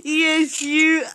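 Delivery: normal talking voice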